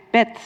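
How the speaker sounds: "bed" ending in a T sound; final devoicing. Shown as a demonstration. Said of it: "it loses the voicing".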